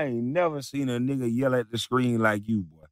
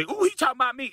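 much higher whiny mock voice; mocking falsetto; 13 semitones higher, faster than usual. Haters recording him, mimicked in high mocking falsetto. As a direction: sneer at them in a squeaky falsetto.

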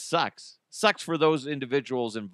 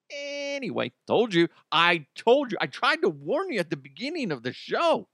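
usual speaking voice

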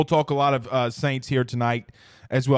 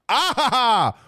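hype laugh; 7 semitones higher, 3 dB louder, slower than usual. Acted. Retake of show opener.

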